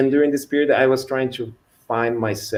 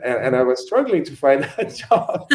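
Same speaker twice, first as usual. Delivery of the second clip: laughing while talking; 5 semitones higher, 3 dB louder than usual. Real, not acted. Laughs about job struggle.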